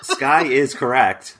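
loud, laughing while talking; cracking up mid-announcement. Real, not acted. Host laughing at the trick answer.

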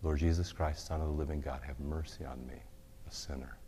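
soft, fading prayer; quiet repeated prayer. Shown as a demonstration. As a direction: murmur it, letting it sink. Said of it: "you say".

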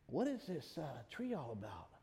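soft, low wondering to oneself; hushed, idle curiosity; curious musing. Acted. Voicing Adam's curious wondering.

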